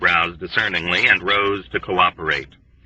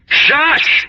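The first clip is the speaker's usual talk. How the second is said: much higher, loud frantic shush; roared shush; 5 dB louder than usual. A narrator's reading. Dobbs to Yossarian, roaring in terror. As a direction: roar the hush in terror. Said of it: "he roared".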